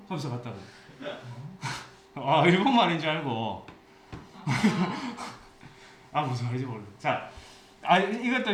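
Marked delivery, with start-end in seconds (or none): 1.0-1.8 soft breathy chuckle at the punchline; quiet laugh. Real, not acted. Teacher laughs at own story. 4.1-5.3 quick chuckle at the joke; short laugh. Real, not acted. Teacher laughs at own story.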